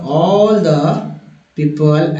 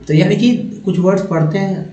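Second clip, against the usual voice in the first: ordinary talk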